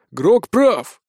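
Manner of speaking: lightly croaked agreement; a touch of hoarse brigand. A narrator's reading. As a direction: rasp it as a rough bandit. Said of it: "прохрипел второй голос".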